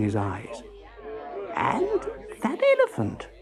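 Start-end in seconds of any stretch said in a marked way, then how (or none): 1.6-3.3 very high, soft disbelief; pause, then squeak in bewilderment; astonished. A narrator's reading. Husband spotting the elephant outside too, astonished.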